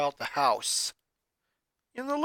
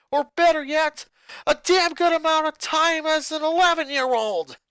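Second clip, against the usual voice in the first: building from firm to loud yelling; rising angry yell; 6 semitones higher, 6 dB louder than usual. Acted. Boy refuses to apologize to his father, anger building to a yell. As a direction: let the anger climb to a shout. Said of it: "he yelled, his anger rising".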